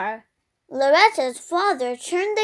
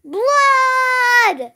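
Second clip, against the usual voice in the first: very long, high, loud cry; drawn-out shriek, falling at the end; urgent yell; 10 semitones higher, 5 dB louder than usual. A narrator's reading. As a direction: yell one stretched word to raise the alarm. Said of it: "pointed at her brother and yelled".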